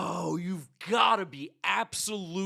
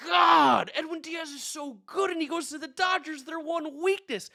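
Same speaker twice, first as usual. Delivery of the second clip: loud, much higher furious yell at the news; real angry yelling; 9 semitones higher, 6 dB louder than usual. Real, not acted. Mets fan yelling to viewers about the signing, furious shout. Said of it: "cursing and screaming and yelling".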